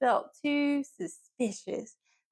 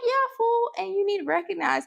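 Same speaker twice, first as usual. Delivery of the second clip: sassy high scold; 8 semitones higher, faster than usual. Retold or imitated. Scolding Colin with sass.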